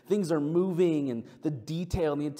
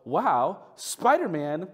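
higher, louder imitation of an awestruck five-year-old; amazed child; 5 semitones higher, 4 dB louder, slower than usual. Five-year-old seeing Spider-Man in line, imitated amazement. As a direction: marvel like a small kid spotting a hero. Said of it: "she was amazed".

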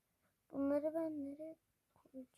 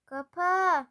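loud, higher, drawn-out command; exasperated shout; 4 semitones higher, 13 dB louder than usual. Real, not acted. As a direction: yell it at the stubborn suitcase. Same command, suitcase will not close.

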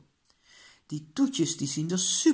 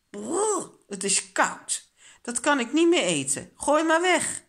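high-pitched shudder, then loud refusal; disgusted; 9 semitones higher, 4 dB louder than usual. A narrator's reading. Child tastes cold spaghetti, disgusted refusal. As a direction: recoil from the food in revulsion. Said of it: "trekt ze een vies gezicht".

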